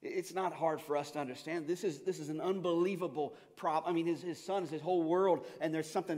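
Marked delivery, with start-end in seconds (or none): none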